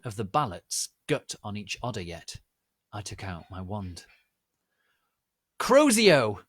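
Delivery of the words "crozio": high, loud spell cry; battle shout. Acted. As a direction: fling the curse out. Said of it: "I shouted".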